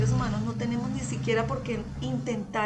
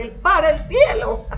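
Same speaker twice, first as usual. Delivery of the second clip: crowd answer; 6 semitones higher, 10 dB louder, slower than usual. Retold or imitated. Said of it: "la gente tenía que contestarle".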